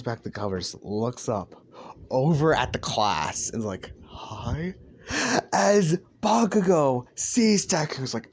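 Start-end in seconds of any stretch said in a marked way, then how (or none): 4.1-4.7 small, soft questioning hello; sheepish greeting. Acted. Deku looking up at the class, sheepish and quiet. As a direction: offer it meekly, caught out.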